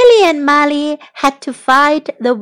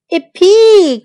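high, loud whoop of joy; gleeful high holler; excited cry; 7 semitones higher, 8 dB louder than usual. A narrator's reading. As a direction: whoop it out as you take off. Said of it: "cried Tom".

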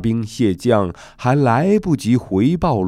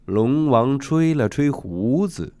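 usual speaking voice

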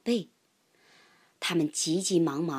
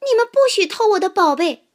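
high, loud and fast protest; guard the treasure fiercely; angry squirrel shout; 12 semitones higher, 10 dB louder, faster than usual. A narrator's reading. Squirrel forbids them stealing its treasure, angry shout. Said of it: "松鼠叫起来".

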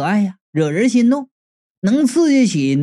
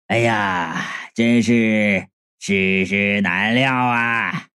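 low, slow elder voice; drawn-out sighing lament, laugh slipping in; old martial uncle, lamenting with a chuckle; 10 semitones lower, much slower than usual. Acted. Uncle hears the whole story, low mock lament.

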